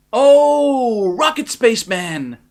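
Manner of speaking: hammed-up gushing cry; cried enthusiastically. A narrator's reading. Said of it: "the president cried, as enthusiastically as a shoe".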